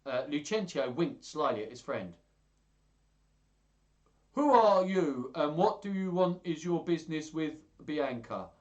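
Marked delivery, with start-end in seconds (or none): 4.3-8.5 loud, raised challenge; high, pushy put-on voice; common-sounding demand. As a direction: demand answers from a rival in a common voice. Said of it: "demanded Hortensio, trying to act common".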